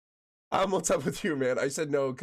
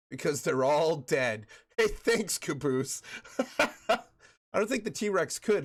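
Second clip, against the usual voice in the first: ordinary talk, nothing marked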